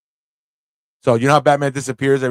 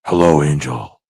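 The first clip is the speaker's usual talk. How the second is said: deep put-on voice; 8 semitones lower, 4 dB louder than usual. Mock greeting voice.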